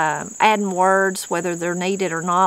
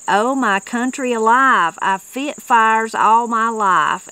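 put-on slow, louder old mountain man voice, higher; quoting Lewis Reagan; 3 semitones higher, 5 dB louder, slower than usual. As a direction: drawl it out like an old Tennessee woodsman. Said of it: "And that was Lewis Reagan".

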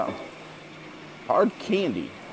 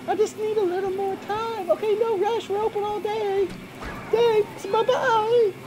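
much higher, sweet shopper voice; high character voice. Acted. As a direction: play the eager young shopper. Voicing game character dialogue.

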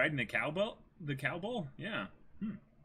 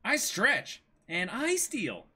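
higher, cheerful declaration; Luffy's boast; 7 semitones higher, slower than usual. Acted. Luffy to Usopp, cheerfully listing what he can do. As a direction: say it proudly and simply. Reading Luffy and Nami lines.

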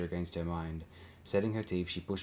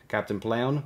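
higher, louder officer's call; raised voice hailing a superior; 4 semitones higher, 6 dB louder, slower than usual. A narrator's reading. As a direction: call up to your captain. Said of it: "the comm officer called up to him".

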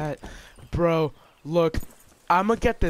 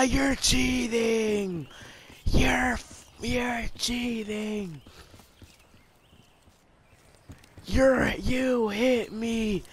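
whiny complaint; 6 semitones higher than usual. Real, not acted. Whining after losing fight.

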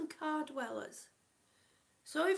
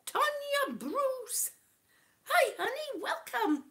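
high, loud welcome; excited greeting; 8 semitones higher, 5 dB louder than usual. Real, not acted. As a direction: beam a welcome to a viewer. Greeting live chat viewer.

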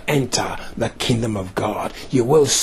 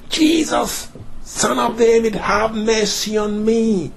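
acted shouting; 10 semitones higher than usual. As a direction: call out insistently as the pleading blind man. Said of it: "He shouted again".

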